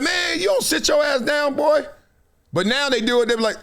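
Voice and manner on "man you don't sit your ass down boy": mock yell. Snapping at someone before.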